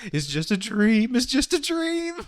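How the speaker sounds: high, drawn-out panicked cartoon cry; Fear. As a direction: wail it in exaggerated panic. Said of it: "Fear was just like".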